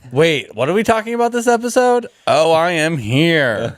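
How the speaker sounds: high-pitched teasing imitation of a clueless latecomer; mock latecomer. Mimics Caleb just catching up.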